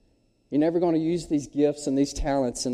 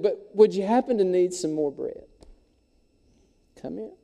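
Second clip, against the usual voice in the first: hesitant baker; 6 semitones higher than usual. Acted. Hesitant baker offers bread.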